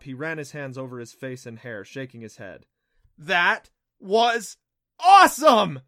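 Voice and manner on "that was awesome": loud, high whoop of delight; exhilarated shout; excited cry. Acted. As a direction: cry it out, thrilled, building to a peak. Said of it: "Adam cried, slapping the surface excitedly".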